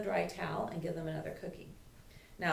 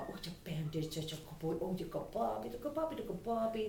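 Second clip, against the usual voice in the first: softer, dreamy pampered dog voice, rising higher on the puppy lines; dog at the spa; 4 semitones higher, 3 dB quieter than usual. Retold or imitated. Trainer voicing a dog enjoying the spa bath, softly. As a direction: coo like a dog being pampered. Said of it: "have your dog say at the spa".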